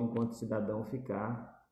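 usual speaking voice

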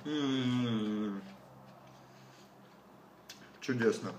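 chewing tomato salad; talking between bites; mouth full, chewing. Real, not acted. Seen 2: Forks tomato salad into mouth at 1.8s, chews with full cheeks after.

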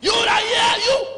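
high, loud, slow cry to the church; shout. Real, not acted. Preacher shouting at the congregation, loud and slow. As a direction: call it out with full force.

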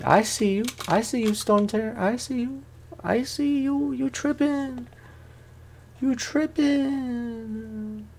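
sing-song falsetto teasing, high and soft; high mocking taunt. Gamer taunting the boss, high falsetto sing-song. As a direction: tease the boss in a thin high voice.

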